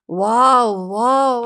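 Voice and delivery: put-on a little girl clapping with joy; happy wow. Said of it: "అని clap చేసింది పరి ఆనందంగా".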